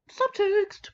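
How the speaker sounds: high, loud triumphant exclaim; squealed subtext callout; gleeful shout. Real, not acted. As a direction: announce the gossip with delight. Gleeful reveal while reading.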